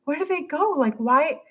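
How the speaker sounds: high, baffled asking; mock dismay. Creator puzzling over silent followers, high baffled whine. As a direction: plead to know where they went. Wondering why comments stopped.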